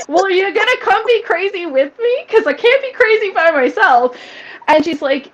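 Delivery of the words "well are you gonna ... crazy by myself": high, loud, giddy retold invitation; playful excitement. Mother retelling reply to stepdaughter calling her crazy, giddy. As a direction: bubble over, pitched way up.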